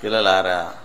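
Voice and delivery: low, soft call to disciples; Jesus. Retold or imitated. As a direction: drop deep and call them softly. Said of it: "శిష్యుల్ని ఏమని మాట్లాడేవాడు?". (లోతైన, మెత్తని పిలుపు)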